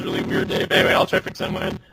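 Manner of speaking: glitchy robot voice; low, fast robotic distortion; robotic mic. Real, not acted. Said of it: "your mic's sounding kinda robotic".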